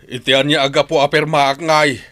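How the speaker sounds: loud, high cry of protest; desperate shout. Acted. Cornered husband cries out at the masked men, loud. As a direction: yell it out, cornered and scared.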